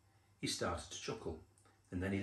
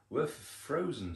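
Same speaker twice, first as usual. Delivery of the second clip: higher stuttering shiver; 6 semitones higher, 4 dB louder than usual. A narrator's reading. Second rabbit at the door, shivering with cold. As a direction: stammer the first sound as if chilled through. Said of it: "two shivering rabbits".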